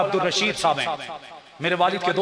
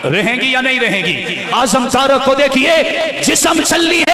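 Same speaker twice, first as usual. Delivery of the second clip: loud, higher call to the crowd; rallying shout; 4 semitones higher, 7 dB louder than usual. Acted. Preacher calling on the crowd to answer, loud. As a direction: rouse them to answer.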